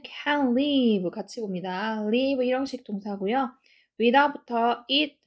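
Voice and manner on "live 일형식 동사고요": normal talking voice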